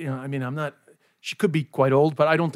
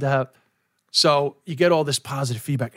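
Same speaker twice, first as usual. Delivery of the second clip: ordinary talk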